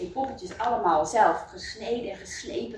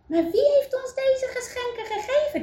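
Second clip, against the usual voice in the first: much higher, curious; wondering wife. Acted. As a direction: ask the question lightly. Wife wonders about the giver. (veel hoger, nieuwsgierig)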